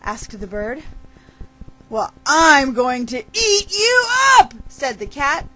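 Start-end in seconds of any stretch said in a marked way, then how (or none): 1.9-4.5 high, loud storybook menace; cat crowing over its prey; gleeful predator threat. Acted. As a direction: build up and pounce on the threat. Cat threatens to eat the bird.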